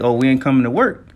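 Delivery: normal talking voice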